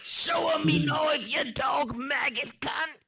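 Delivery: high, slow Australian goblin shout; Australian accent. Acted. Goblin taunting with a knife, shouted Australian accent. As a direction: holler it as a hammed-up Australian goblin. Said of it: "all these goblins are Australian".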